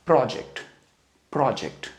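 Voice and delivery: Project with the weight on PRO, falling off the end; stress on first syllable. Shown as a demonstration. Teacher showing learners the noun stress of project, PRO first. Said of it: "इसका first syllable".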